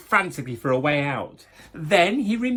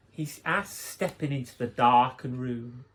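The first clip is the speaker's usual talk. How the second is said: ordinary delivery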